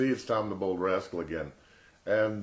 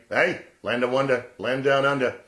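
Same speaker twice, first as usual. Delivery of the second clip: put-on Australian accent; 3 semitones higher, 7 dB louder than usual.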